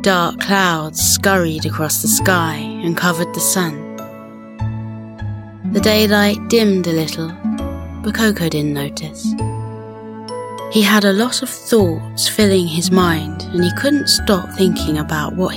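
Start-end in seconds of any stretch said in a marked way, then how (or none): none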